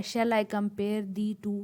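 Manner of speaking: drawn-out, measured reading of the line; slow line reading. Shown as a demonstration. Teacher reads out the example line, slow and measured. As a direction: recite the verse line very slowly. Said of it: "जैसे ये एक line आपके सामने मौजूद".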